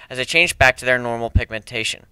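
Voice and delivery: ordinary delivery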